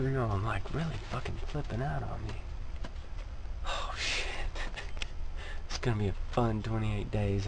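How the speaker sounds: low, hushed nervous talk, kept under his breath; nervous hushed griping; hushed venting. Real, not acted. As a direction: keep it down so the trainer won't hear. Venting secretly near trainer.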